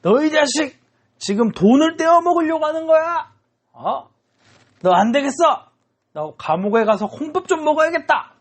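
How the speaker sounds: high-pitched acted angry tirade; furious creditor. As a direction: threaten a debtor as if grabbing his collar. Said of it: "그래서 멱살을 잡고".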